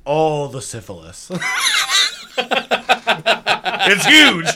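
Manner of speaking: laughing. Real, not acted. Laughing punchline.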